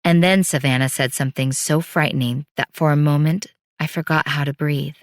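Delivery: ordinary delivery, nothing marked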